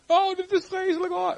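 hoge, overdreven paniekkreet; gespeelde paniek. Acted. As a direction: speel iemand die in paniek jammert. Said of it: "in panieksituaties". (aangezette paniek met hoge uithaal)

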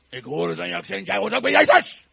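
soldier's accusation building to a loud shout; tough soldier. Acted. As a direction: start firm, then shout the charge. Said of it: "een hele stoere soldaat".

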